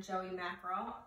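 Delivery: ordinary delivery, nothing marked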